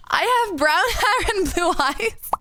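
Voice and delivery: giggling, slow and high; laughing through words. Pretend. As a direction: laugh through the mock intro. Mock self-introduction in chat.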